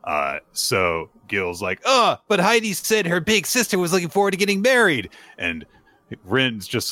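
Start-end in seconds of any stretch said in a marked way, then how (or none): 1.9-5.1 high-pitched, incredulous objection; mock protest. Gil objecting to the fiance's story, mock high protest. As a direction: exaggerate a worked-up retort.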